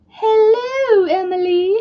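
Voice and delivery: high, slow, sing-song hello; Mrs. Doubtfire hello. Retold or imitated. Greeting viewer Emily in high Mrs. Doubtfire voice. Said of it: "says hello like that".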